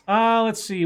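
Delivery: ordinary talk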